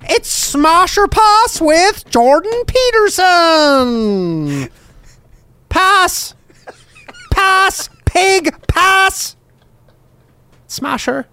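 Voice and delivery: high, slow Jordan Peterson voice; Jordan Peterson impression. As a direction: do Peterson judging contestants. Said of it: "you can do a good Peterson".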